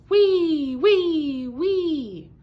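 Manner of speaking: high, loud wee snore; whistling snore. A narrator's reading. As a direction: whistle like a snoring mouse. Snoring sound.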